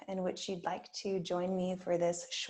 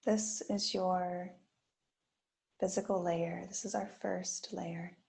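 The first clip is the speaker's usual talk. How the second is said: guided meditation. Acted. Yoga teacher guiding students through a body scan, gently. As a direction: ease listeners into stillness with long pauses.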